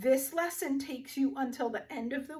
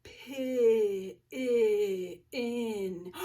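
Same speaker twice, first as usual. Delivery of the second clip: "Pi-, i-, in" with short ih stretched in pulses; slow sounding-out; much slower than usual. Shown as a demonstration. Teacher checking pin for its vowel, slowly sounded out. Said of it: "Let's say this one slow".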